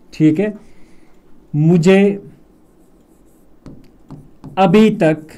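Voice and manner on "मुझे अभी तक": long pauses between the words, firm and clear; slow dictation. Shown as a demonstration. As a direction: spell the sentence out slowly for the class. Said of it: "ध्यान रखना". (धीमे-धीमे, रुक-रुक कर बोलना)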